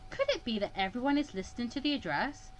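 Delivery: ordinary delivery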